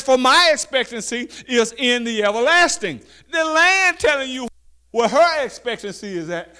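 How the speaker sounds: high, loud, slow preacher's call; preaching scripture. Acted. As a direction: proclaim it to the congregation. Reads and preaches the verse.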